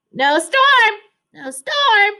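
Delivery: high loud quacking cry; quacking duck. A narrator's reading. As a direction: call it out like an excited duck. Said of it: "he quacked".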